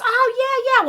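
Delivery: high-pitched imitation of a friend's casual reply; imitating Karen. Friend brushing off the reporting question, high imitated voice. Karen's quick answer in retold dialogue.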